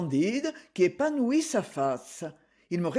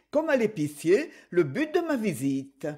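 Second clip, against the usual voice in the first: usual speaking voice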